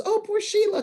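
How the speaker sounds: high, sing-song mock sympathy; mock pity. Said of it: "not so the audience goes".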